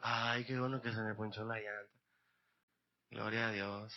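low, soft sigh of gratitude; quiet relief. Acted. Believer later thankful the tire went flat, quietly relieved. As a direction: murmur thanks, acting out hindsight.

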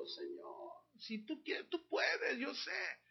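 susurro que se quiebra en llanto, agudo; oración llorosa. Retold or imitated. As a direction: ruega con la voz quebrada. Ana ora llorando a Dios. (Ana ora a Dios con la voz quebrada por el llanto)

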